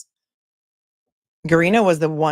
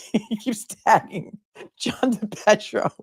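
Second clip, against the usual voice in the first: talking through giggles, pitched up and slow; laughing; 4 semitones higher, slower than usual. Real, not acted. Host amused at reporter tagging his rival, laughing. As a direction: barely get the words out for laughing. Breaks up laughing over the tweets.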